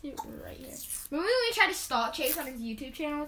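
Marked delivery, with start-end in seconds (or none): 0.0-0.7 scolding mom in a hissed whisper; whisper-yell at a naughty child. Pretend. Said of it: "whisper yell at your child".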